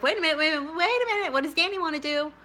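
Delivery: high, excited inner voice. Retold or imitated. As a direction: catch yourself, pitch jumping up. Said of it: "I would think to myself".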